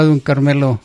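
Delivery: ordinary talk, nothing marked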